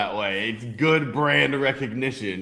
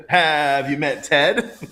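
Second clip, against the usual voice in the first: slow, loud, higher pickup-line delivery; sitcom catchphrase; 6 semitones higher, 6 dB louder, much slower than usual. Retold or imitated. Man quotes How I Met Your Mother, playful catchphrase delivery. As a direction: draw it out like a show catchphrase. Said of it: "if you ever watch How I Met Your Mother".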